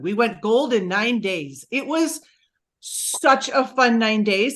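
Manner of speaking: ordinary talk, nothing marked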